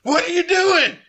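high, exasperated outburst; frustrated. Acted. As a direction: demand it in acted exasperation. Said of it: "like frustrated face".

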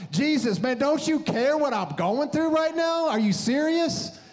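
high-pitched, frantic protest to God; exasperated plea. Retold or imitated. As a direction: plead in hammed-up desperation, pitch climbing. Acting out frantic plea to Jesus.

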